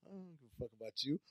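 very soft, quick aside; dismissive mock dad. Co-host acting the uncaring dad's reply, dismissive and quiet. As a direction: toss it off quietly and fast.

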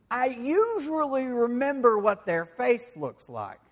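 high, slow, pointed accusation from a servant; accusing servant. Acted. Servant challenging Peter about the ear, slow and high. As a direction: lean in like a servant who's sure he saw you.

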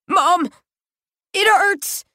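high, weak wounded cry; crying for mom. Acted. Stabbed boy crying out for his mom in pain. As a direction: call for mother through pain.

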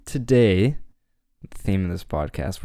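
usual speaking voice

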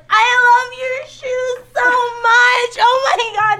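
high, slow, loud gushing squeal; gushing drunk girl. Retold or imitated. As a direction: squeal over a stranger's shoes. Imitating drunk girls in bathroom.

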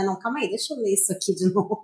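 all in one breath. Retold or imitated. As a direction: rattle it off quietly to yourself. Acted self-quote rereading a page.

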